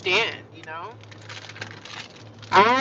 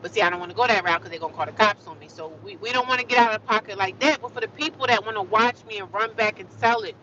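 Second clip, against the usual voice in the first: ordinary talk, nothing marked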